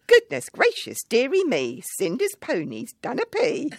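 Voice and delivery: high, loud sing-song panto rhyme; pantomime Buttons. Retold or imitated. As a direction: belt it out like a panto comic to the kids. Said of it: "returned ... with a mop and the lines".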